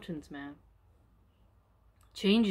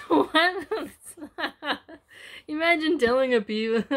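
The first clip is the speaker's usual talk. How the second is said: high laughing "what", can't believe it; laughing disbelief; 8 semitones higher, 6 dB louder than usual. Real, not acted. Reader amused by the tribes' fates, laughing what. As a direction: laugh through the question.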